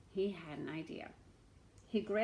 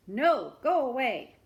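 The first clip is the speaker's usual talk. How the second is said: much louder no; loud refusal; 4 semitones higher, 11 dB louder than usual. Acted. Villager shouting the beggar away, loud. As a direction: bark the refusal.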